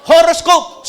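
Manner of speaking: shouting the word he finally remembered; eureka shout. Real, not acted. Pastor remembering the word, shouting it to the crowd. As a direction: blurt it out, high and loud. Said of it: "hindi, scorpion?".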